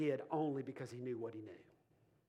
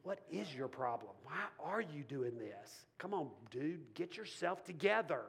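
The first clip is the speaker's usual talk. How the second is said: self-scolding inner voice; 4 semitones lower, 5 dB quieter than usual. Retold or imitated. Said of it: "talk to themselves".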